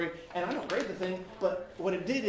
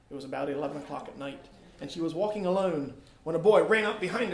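lively read-aloud narration, louder; reading a story aloud to students; 2 semitones higher, 5 dB louder than usual. Acted. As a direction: read the story to the class with drive. Reads story aloud to class.

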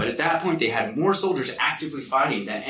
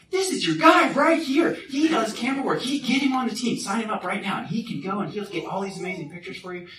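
hammed-up enthusiasm; 10 semitones higher than usual. Friend volunteering the speaker to the recruiter, gushing. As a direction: talk him up as an overeager friend.

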